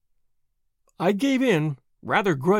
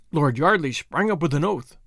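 usual speaking voice

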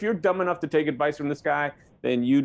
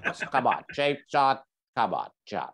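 low, trailing teacher impression; exasperated grade ten teacher, sinking lower and softer; Mister Kerr; 6 semitones lower, 3 dB quieter than usual. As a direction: imitate a weary schoolteacher pleading with a pupil. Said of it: "that's my grade ten teacher, Mister Kerr".

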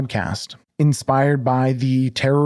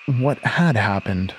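story narration; 2 semitones lower, 3 dB quieter than usual. A narrator's reading. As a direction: read it like a dark tale unfolding.

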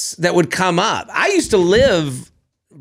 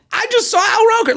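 much higher, fast, thrilled; excited fan; much faster than usual. Retold or imitated. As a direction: gush it like you just saw a celebrity. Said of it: "people are like".

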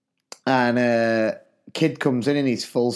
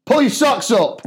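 loud, high-pitched telling-off; shouted retold order to a pupil; teacher's shout; 12 semitones higher, 6 dB louder than usual. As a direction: call sharply across a classroom. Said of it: "went over and went".

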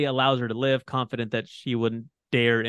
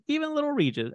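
squeaky voice; faster than usual. Pretend. Teasing squeaky emphasis.

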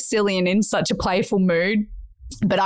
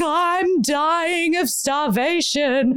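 put-on high, slow, sing-song kid's whine; whiny starving kid; 8 semitones higher, much slower than usual. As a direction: drag it out like a starving child. Hungry kids complaining to mum.

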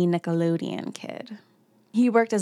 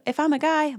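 ordinary talk, nothing marked

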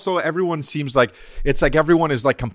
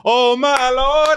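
high, slow, louder gospel moan; louder mock gospel; 10 semitones higher, 4 dB louder, much slower than usual. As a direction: swell it back up like a choir. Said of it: "they get louder again".

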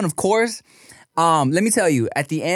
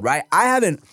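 ordinary talk, nothing marked

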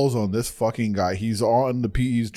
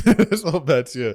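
It's spelled Mathieu, higher and louder through a laugh; laughing insistence; 5 semitones higher, 5 dB louder than usual. Real, not acted. Laughing back at a friend mocking the name.

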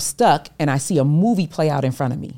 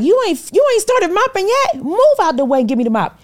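high, pushy brother voice, a bit louder; big brother scolding; 4 dB louder than usual. Retold or imitated. Manager grabs the mop from her, high and scolding. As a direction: boss her away from the mop.

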